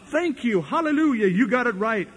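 mock smug preacher. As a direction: answer the insult with smug glee.

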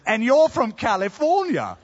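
high-pitched mock English accent; English accent imitation. London waiter seeing through the tourist, imitated English accent. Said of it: "the guy looked at me and said".

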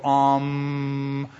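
a lightly voiced meditative hum, mocking; mock meditation hum. Preacher mocking transcendental meditation with a hum. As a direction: hum like someone emptying their mind. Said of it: "put your hands out like this and go".

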